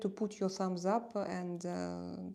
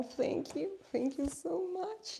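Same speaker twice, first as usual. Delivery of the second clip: high, lilting, drawn-out thank-you; delighted thanks; 10 semitones higher, slower than usual. Real, not acted. Streamer to viewer praising her decor, delighted thanks.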